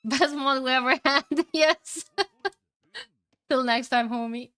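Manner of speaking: high, laughter-filled praise; laughing. Real, not acted. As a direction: laugh your way through the farewell. Laughs thanking a moderator.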